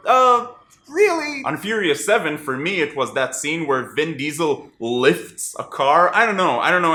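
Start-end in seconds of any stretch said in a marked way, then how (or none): 0.1-1.4 high, disbelieving "really?"; incredulous. Retold or imitated. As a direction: sound like you can't believe it. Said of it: "makes you go kind of".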